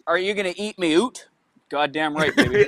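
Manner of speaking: put-on Canadian accent saying oot for out. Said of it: "That's exactly what they sound like".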